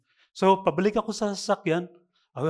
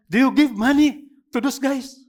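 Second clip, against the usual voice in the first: loud, higher, angry demand; angry question; 5 semitones higher, 6 dB louder than usual. Retold or imitated. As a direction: confront him loudly. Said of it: "Galit eh".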